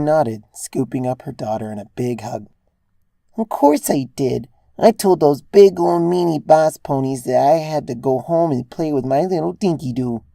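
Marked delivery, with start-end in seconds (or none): 3.4-10.2 lightly voiced mother cooing to her child; a hint of a doting mom. A narrator's reading. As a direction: coo playfully at a little daughter. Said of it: "she cooed".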